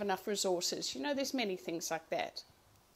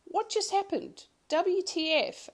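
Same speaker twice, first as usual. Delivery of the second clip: indignant disbelief; 9 semitones higher, 3 dB louder than usual. Retold or imitated. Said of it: "I'd be going".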